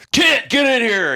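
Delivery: loud, high shouted summons; barked order. Retold or imitated. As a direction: yell his name like an angry boss. CMC yelling at him.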